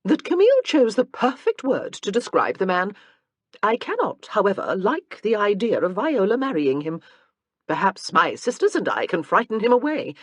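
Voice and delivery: Louise's voice. A narrator's reading.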